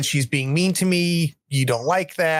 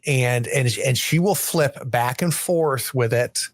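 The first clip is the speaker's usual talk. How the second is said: ordinary talk, nothing marked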